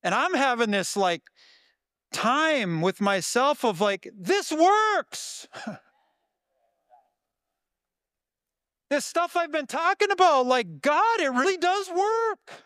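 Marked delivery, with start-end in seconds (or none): 4.2-12.7 much higher and brighter, breaking into laughs; delighted surprise. Real, not acted. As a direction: marvel at it out loud, laughing. Joyful discovery that prayer works.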